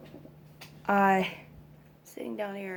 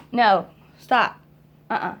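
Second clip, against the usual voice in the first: sharp no; 8 dB louder than usual. Real, not acted. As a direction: snap him out of it with a loud stop. Toddler grabbing tablecloth again.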